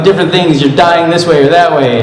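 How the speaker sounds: ordinary delivery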